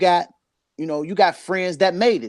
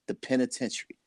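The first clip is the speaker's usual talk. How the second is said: much softer, the word just dropping off; quiet and bitter; 3 semitones lower, 9 dB quieter than usual. Real, not acted. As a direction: let the penitentiary fall flat and quiet, almost under the breath. Son's whereabouts, dropped bitterly.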